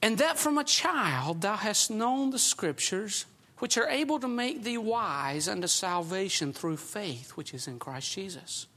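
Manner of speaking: slow, low, soft reading of the verse; scripture reading. Acted. Preacher reads scripture to the congregation, slow and low. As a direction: read it deliberately, weighing each phrase.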